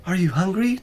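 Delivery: put-on high, caring young woman; woman fussing over the cat; solicitous. A narrator's reading. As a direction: ask it gently, concerned. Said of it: "Joanna asked solicitously".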